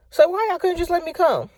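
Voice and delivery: high, incredulous complaint; fed-up high squeal; exasperated. Real, not acted. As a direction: throw the question up in disbelief. Exasperated rhetorical question.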